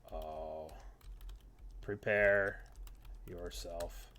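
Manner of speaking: low, slow, quiet villain taunt; menacing trash talk. Acted. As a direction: growl the threat softly. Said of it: "Just a little friendly trash talk".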